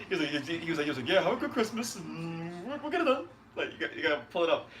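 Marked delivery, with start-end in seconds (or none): 1.1-3.3 higher imitation of a brief phone sign-off; pitched-up mimicked promoter; Al Haymon. Host copies another boxer's promoter impression, higher put-on voice. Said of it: "impression of Al Haymon".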